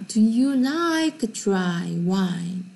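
very slow, careful English reading; slow model sentence. Shown as a demonstration. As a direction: say it slowly for repetition. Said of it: "Listen and repeat".

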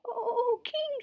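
tiny high-pitched rabbit voice; very high, meek animal voice; timid rabbit. A narrator's reading. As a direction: squeak it like a timid little creature. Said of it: "The timid little creature".

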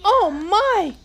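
high loud "oh my"; dismayed cry. Acted. King finding his juice turned gold, high loud dismay. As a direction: gasp in dismay.

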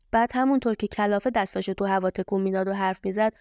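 ordinary delivery, nothing marked

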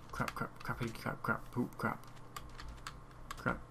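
soft string of mild curses; nervous muttering. Real, not acted. Gamer trying not to fall, nervous muttering. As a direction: mutter anxiously under the breath. Nearly falling in the game.

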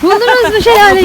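high loud call. Real, not acted. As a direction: shout it across to friends far off. Calling out to friends ahead.

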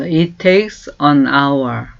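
very slow, clear English for learners to copy; slow model phrase. Shown as a demonstration. Teacher slowly modelling a question. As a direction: stretch each word for repeating. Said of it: "이렇게 말해요".

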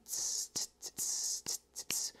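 whispery tss in swing rhythm; swing hi-hat imitation. Shown as a demonstration. Teacher showing a swing rhythm, soft voiceless hi-hat imitation. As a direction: softly hiss out a swung cymbal pattern. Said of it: "like a swing".

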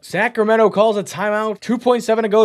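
rapid excited commentary; excited call. Real, not acted. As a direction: ride the thrill of the play. Late go-ahead layup.

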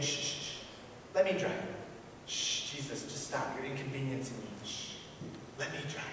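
hushed, soft shushing whisper. Acted. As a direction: shush him away under your breath. Sinner shushing Jesus to take reins.

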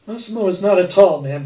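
plain reading voice

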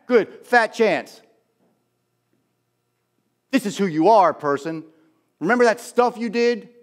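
high, scornful accuser with a scoffing laugh; taunting devil. Acted. Devil telling someone they will never be better, taunting. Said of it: "The devil uses shame".

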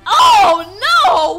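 loud, high shout of dismay; big, high-pitched 'oh no'; horrified cry. Acted. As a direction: cry out in alarm. Acted horror at strangers' kiss.

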